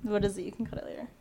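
very soft, voiceless whisper; hushed aside. Real, not acted. Friend nudges her to share it off the record, whispered.

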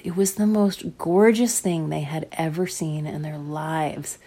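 plain reading voice

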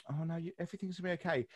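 soft, quick granny-style reassurance; comforting granny. Acted. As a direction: lightly imitate a granny soothing someone. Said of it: "the granny who's going".

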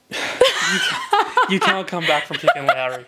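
laughing through the words. Real, not acted. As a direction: tease while cracking up. Teasing co-host over bad pick.

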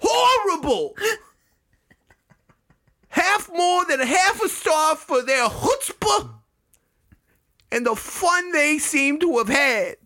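high, yelling New York rant; angry New York guy. Acted. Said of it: "read this review as the angry New York guy who's yelling at the trumpeter".

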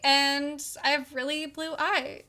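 high, loud, prissy indignation; indignant Henry voice. Retold or imitated. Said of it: "He's like".

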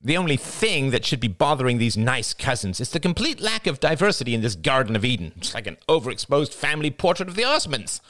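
high, scornful tirade; sneering. A narrator's reading. Jada deriding her cousins' family gathering, high sneer. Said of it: "Jada sneered".

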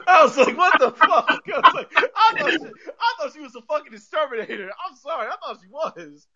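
high-pitched, laughing through the words; incredulous, helpless laughter in the voice; laughing protest. Real, not acted. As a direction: defend yourself while cracking up. Laughing through his own embarrassed defense.

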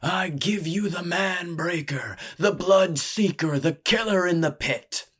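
showman's booming fight introduction. A narrator's reading. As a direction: introduce a champion with grand, building menace. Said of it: "he boomed".

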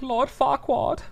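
put-on high, plummy British-accented Lord Farquaad. Friend demonstrating how the film says it, in a British accent. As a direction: say the name like a posh British character. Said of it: "in a British accent".

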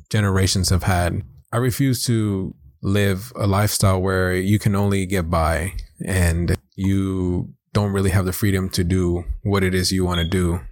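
ordinary delivery

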